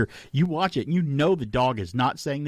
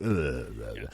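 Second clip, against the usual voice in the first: husky grumble; 13 semitones lower, 7 dB quieter than usual. Retold or imitated. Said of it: "And he's like".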